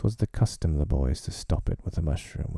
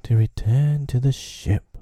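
slowly through teeth; 7 semitones higher, 4 dB louder than usual. A narrator's reading. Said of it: "Hook replied slowly through his teeth".

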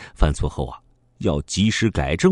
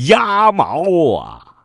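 shocked; 12 semitones higher, 7 dB louder, much slower than usual. A narrator's reading. Discovering duck feathers on body.

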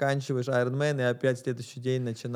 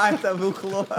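laughing; 6 semitones higher than usual. Real, not acted. Echoes the joke, laughing.

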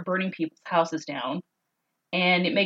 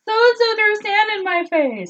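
put-on high, louder little-kid complaint; put-on child's voice, pitched way up; whining child; 5 dB louder than usual. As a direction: tattle like a kid home from school. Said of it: "her kid come home from school and say".

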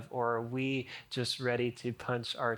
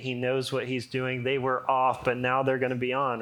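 ordinary talk

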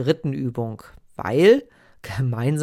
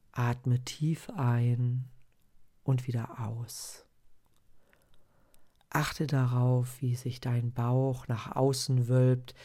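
guided meditation. Acted. Leads a breathing meditation.

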